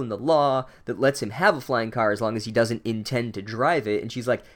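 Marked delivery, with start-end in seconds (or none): none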